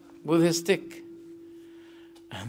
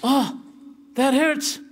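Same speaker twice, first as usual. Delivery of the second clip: pained complaint; 9 semitones higher than usual. Retold or imitated. Sawad complains to the Prophet about the stick, high mock pain. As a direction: whine that the stick stung. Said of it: "Sawad says".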